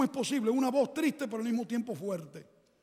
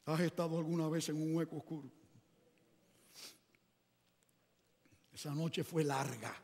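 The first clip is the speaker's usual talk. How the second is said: hushed; 7 semitones lower, 5 dB quieter than usual. Acted. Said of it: "preacher hushes for dark pit".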